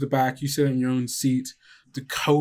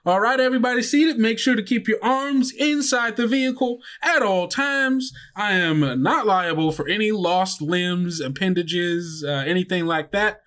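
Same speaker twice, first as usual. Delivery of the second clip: much higher and louder, fast spiel; perky bus driver; 8 semitones higher, 4 dB louder than usual. Acted. Driver Lucy to seated kids, high fast cheerful spiel. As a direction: rattle off the safety rules like a thrill ride. GM voices Lucy's safety spiel.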